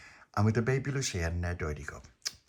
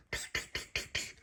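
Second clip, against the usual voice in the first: soft voiceless tick knocks; imitated knock; 10 dB quieter than usual. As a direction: tap the knock quietly with your mouth. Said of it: "a frantic knock on the front door".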